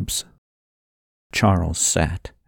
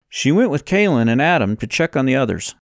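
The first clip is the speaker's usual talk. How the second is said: lightly voiced, higher-pitched character; Levi, informing a friend; 6 semitones higher, faster than usual. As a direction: report back in a slightly raised voice.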